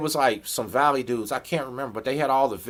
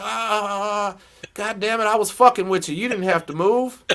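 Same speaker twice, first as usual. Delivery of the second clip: laughing guard; 7 semitones higher than usual. Retold or imitated. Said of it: "just started laughing like a motherfucker".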